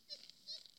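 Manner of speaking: soft high giggle; soft laugh. Real, not acted. Vlogger giggling to herself, soft high giggle.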